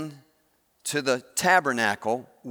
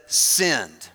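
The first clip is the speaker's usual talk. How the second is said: dictating; 6 semitones lower than usual. Retold or imitated. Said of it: "So I said".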